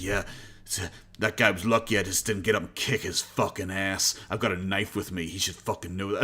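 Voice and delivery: mock tough guy. Streamer imitating Cobra bragging after being kicked out, low mock tough. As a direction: imitate a sulky fake hard man.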